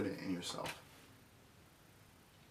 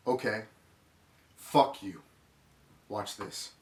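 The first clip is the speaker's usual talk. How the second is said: mild angry defiance, punching the curse louder; retold defiance; 7 dB louder, slower than usual. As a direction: recall throwing down a bitter challenge. Retold defiant reaction to being cut off.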